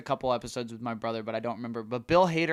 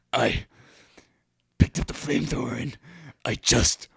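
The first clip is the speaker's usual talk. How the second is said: slow, louder veteran boast; grizzled veteran; 8 dB louder, slower than usual. Retold or imitated. Veteran boasting to the puppet class, slow and louder. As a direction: drag it out like a proud old soldier. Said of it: "war stories through this puppet".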